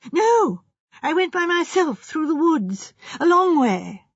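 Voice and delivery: put-on flustered young man protesting; defensive denial. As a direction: insist nervously. Architect defensively denies meeting her.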